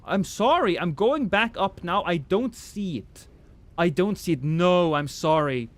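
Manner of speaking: ordinary talk, nothing marked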